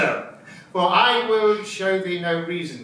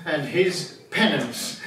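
usual speaking voice

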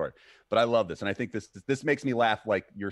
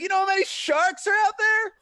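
very high, loud put-on voice; mock stoner warning; 7 dB louder than usual. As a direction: warn as the beach drinker. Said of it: "Like that".

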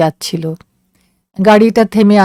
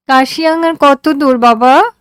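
put-on high, small girl's voice; a tired child asking how far; daughter asking; 7 semitones higher than usual. A narrator's reading. Daughter asking father how far Kurseong is, high and tired.

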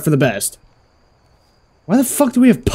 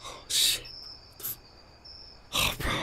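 hushed, whispered dismay; barely voiced disbelief; stunned; 21 dB quieter, slower than usual. Real, not acted. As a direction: let it out under your breath, very softly. Stunned after robbery fails.

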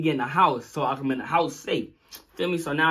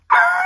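piercing high loud scream; startled high scream; sudden scream; 11 dB louder than usual. Retold or imitated. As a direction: shriek out of nowhere.

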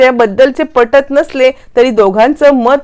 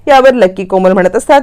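ordinary delivery, nothing marked

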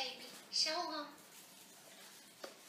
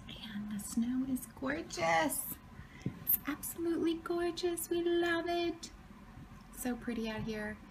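whispering; 5 semitones higher, 10 dB quieter than usual. Real, not acted. Snow morning, baby sleeping.

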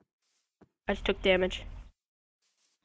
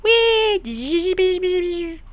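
gleeful wee; 9 semitones higher than usual. Pretend. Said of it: "I'm gonna be like".

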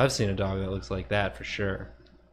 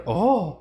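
high mock "oh" of astonishment; imitated tower exclamation; 7 semitones higher, 3 dB louder than usual. Viewer voicing the drawn tower, high mock "oh". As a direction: voice a drawing's open-mouthed cry. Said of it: "looks like it's going".

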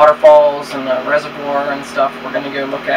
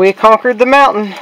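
higher, louder cheer of victory at the summit; triumphant; 5 semitones higher, 6 dB louder than usual. Real, not acted. Parent celebrating with kid at top of mountain, triumphant cheer. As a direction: proclaim the win like you just climbed it.

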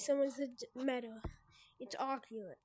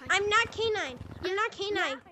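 higher-pitched trolling voice; high mock-innocent troll; K nine; 3 semitones higher than usual. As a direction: do a troll's pleading denial, pitched up. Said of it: "be saying the entire time".

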